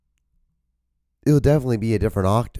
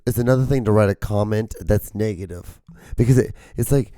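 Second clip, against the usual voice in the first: ordinary talk, nothing marked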